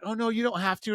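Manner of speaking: high girlish demurral, put on; imitated polite date. Date politely refusing a T-shirt, imitated high voice. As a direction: go up high to mimic her declining.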